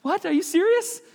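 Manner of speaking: very high squeaky imitation of a tearful woman; mock sobbing Mary. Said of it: "you know, she's sobbing".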